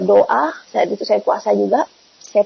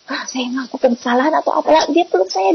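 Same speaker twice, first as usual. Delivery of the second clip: ordinary delivery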